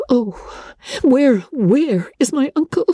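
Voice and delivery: she's desperate to find him now; alarmed cry. A narrator's reading. Elizabeth calls for her uncle after the letter, alarmed and urgent. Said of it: "cried Elizabeth".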